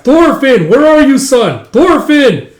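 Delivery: loud, high-pitched searching call; shouting for son. Acted. Father calling out for his son, shouting. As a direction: holler for your missing boy.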